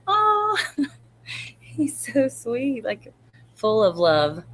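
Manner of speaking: high, drawn-out "oh", then slow cooing; gushing adoration. Retold or imitated. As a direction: melt over a sweet little boy. Retells gushing reaction to her son.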